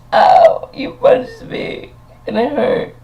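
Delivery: very high, slow squeaky whimper; mock hurt whine. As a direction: whine it like a wounded kid, way up high. Retold whiny reply to puncher.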